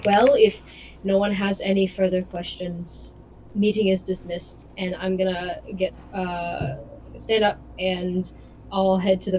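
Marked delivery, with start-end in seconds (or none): none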